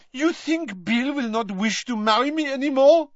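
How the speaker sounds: put-on high French-accented challenge; French accent, demanding. A narrator's reading. Fleur demanding of Mrs Weasley, French accent. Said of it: "demanded Fleur".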